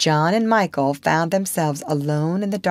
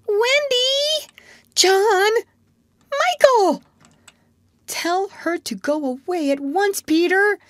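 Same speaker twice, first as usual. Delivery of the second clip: very high-pitched urgent calling out; high, panicked plea; alarmed cries. A narrator's reading. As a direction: cry out like frightened children. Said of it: "the three cried simultaneously".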